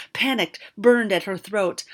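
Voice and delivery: plain reading voice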